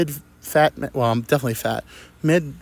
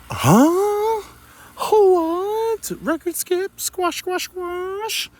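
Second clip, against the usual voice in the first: very high glitching cartoon squeak; confused squeak; much slower than usual. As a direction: sound like a broken record, way up high. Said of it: "And I go".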